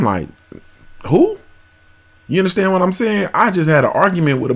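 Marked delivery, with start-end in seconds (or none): 1.1-1.4 sharp high-pitched disbelieving who; baffled who. Retold or imitated. Mocking his own confusion at a rapper name, high disbelieving who. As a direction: squeak it out in total confusion.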